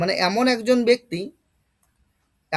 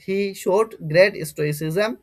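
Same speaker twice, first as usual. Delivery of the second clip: slow example read; much slower than usual. Shown as a demonstration. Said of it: "slow reading of example".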